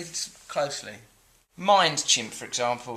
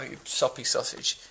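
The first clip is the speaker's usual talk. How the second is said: ordinary talk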